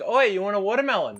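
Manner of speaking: put-on calling up in a broad Aussie accent; Australian accent calling out. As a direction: shout it up to the chairlift, Aussie style. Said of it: "every person you impersonate have an Aussie".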